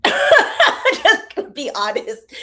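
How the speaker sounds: slow, helpless laugh while admitting it; real laughter breaking into speech. Real, not acted. Laughs at her younger self.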